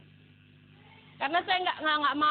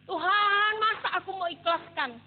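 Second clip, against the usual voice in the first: higher, pleading complaint to God; indignant protest; 4 semitones higher than usual. Retold or imitated. Unpaid worker protesting to God, indignant.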